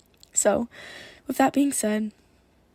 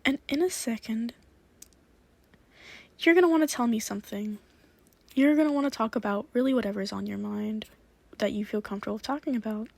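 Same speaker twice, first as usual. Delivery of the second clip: quick cheerful hypnotist patter; upbeat hypnotic suggestion; 4 dB quieter, faster than usual. Acted. New playful suggestion.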